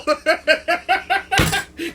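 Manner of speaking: real high laughter. Real, not acted. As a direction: crack up at the memory. Laughing at the story.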